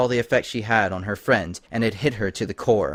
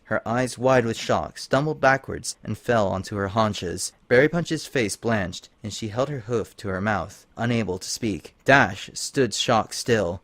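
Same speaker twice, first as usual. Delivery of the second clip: ordinary delivery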